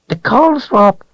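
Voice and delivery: high whining creature voice; creature whine. A narrator's reading. Creature whining to Richard about the Calthrop. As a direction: whine it out, cringing. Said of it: "it whined".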